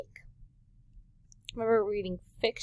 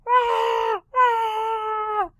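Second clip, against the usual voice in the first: two long, high, loud pretend honks; loud mock goose squawk; imitated goose honks; 12 semitones higher, 5 dB louder than usual. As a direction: honk out like a hungry goose. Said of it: "The geese are all together".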